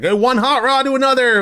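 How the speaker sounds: imitating a fellow fan's cheeky hello; high hammed-up friendly quip; playful greeting. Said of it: "He was like".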